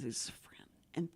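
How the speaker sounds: whispered explanation; slow whispered aside about the wrong signs. Real, not acted. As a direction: whisper it slowly, half to yourself. Explaining the backwards sign.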